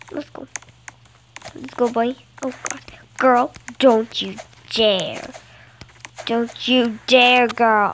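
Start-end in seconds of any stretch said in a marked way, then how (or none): 3.2-7.9 drawn-out, louder don't you dare; slow, loud threat at a rival; slow loud warning. Real, not acted. Gamer warning her rival player, slow and loud.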